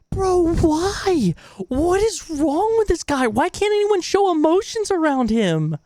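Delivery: high, loud incredulous rant; exasperated outburst. Real, not acted. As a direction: demand answers in disbelief. Outraged reaction to boyfriend's texts.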